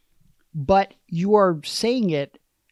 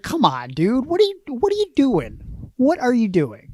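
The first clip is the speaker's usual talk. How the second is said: mock indignant protest; 8 semitones higher than usual. Playfully protesting the baby's rude gesture, high and incredulous. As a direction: pitch jumps high in mock disbelief.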